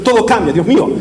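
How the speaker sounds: ordinary talk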